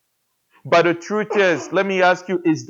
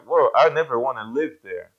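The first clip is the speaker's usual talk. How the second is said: scoffing; 4 semitones lower, faster than usual. Retold or imitated. People voiced scoffing at village.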